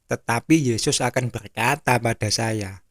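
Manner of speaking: normal talking voice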